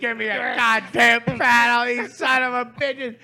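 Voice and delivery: loud, high, hammed-up cursing; pissed off. Paddler cursing the staff who refused him, hammed-up rage. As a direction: rant furiously at the staff, replayed. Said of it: "and I am fucking pissed".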